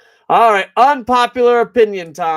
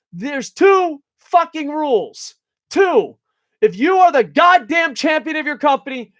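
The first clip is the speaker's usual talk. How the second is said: ranting shout; 6 semitones higher than usual. Real, not acted. Angry rant about title rules.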